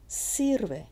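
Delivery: high "Sir" in "Sirve", then falling; stress on SIR. Shown as a demonstration. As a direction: lean on the first syllable of "Sirve". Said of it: "It's on the sir".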